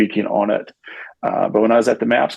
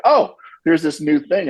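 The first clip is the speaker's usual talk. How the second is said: higher, wide-eyed discovery voice; mock naive wonder; 9 semitones higher than usual. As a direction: parody of a naive newcomer gushing. Mocks Americans discovering ayahuasca.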